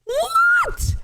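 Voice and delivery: very high shouted what; shocked shout. Real, not acted. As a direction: shriek it in disbelief. Shocked at a lucky win.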